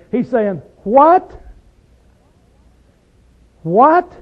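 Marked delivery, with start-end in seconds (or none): 0.8-1.4 high, loud, incredulous "What?" swooping upward; sarcastic disbelief. Acted. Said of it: "[name] is using a very sarcastic way". 3.6-4.1 high, loud, incredulous "What?" swooping upward; sarcastic disbelief. Acted. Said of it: "he don't believe it".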